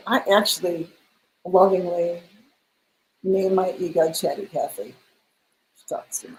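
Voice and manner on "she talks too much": a breathless whisper, far softer, as a private joke; whispered aside. Acted. Telling the host about her chatty ego, whispered aside.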